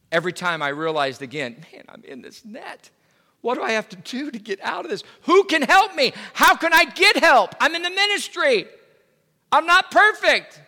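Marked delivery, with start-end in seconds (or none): 1.7-2.9 whispered despair. Retold or imitated. Voices his trapped past self. 3.4-10.6 much higher and louder, pleading from inside the net; desperate cry for help. Retold or imitated. Voices his trapped past self crying out.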